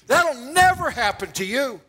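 high, forceful outburst as Peter; emphatic protest. Retold or imitated. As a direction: blurt a shocked refusal, pitch jumping up.

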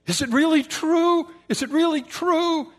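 high, loud disbelieving question; incredulous. Acted. Joseph asking brothers about his father, high disbelief. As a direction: cry out in hope and disbelief.

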